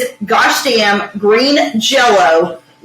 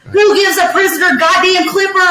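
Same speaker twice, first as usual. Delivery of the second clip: incredulous rant; 7 semitones higher than usual. Real, not acted. Ranting about movie flaws.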